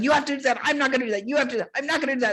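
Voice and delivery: high-pitched mock squabble; yelling argument. Nun and swami yelling at each other, mock argument. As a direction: re-enact a quick back-and-forth yelling match. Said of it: "He would yell at me and I would yell at him".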